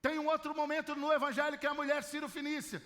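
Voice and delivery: ordinary talk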